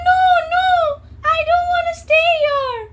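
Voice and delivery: high, loud wailing little bird; squeaky crying birdie refusing the cage. Acted. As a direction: wail it like a tiny upset bird. Bird protests being caged.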